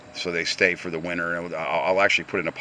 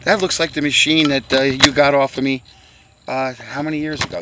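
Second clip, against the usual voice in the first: imitating a fan, pitched higher and louder; quoting people who recognize him; 5 semitones higher, 8 dB louder than usual. A fan calling to Uncle Dutch about his old machine, voiced higher and louder. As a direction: play an eager admirer calling out to Uncle Dutch. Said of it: "Because people say to me".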